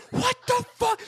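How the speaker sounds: very high mock shriek; outraged kid. Player imitates the kid he keeps sniping, outraged high shriek. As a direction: imitate a kid's squealing outburst.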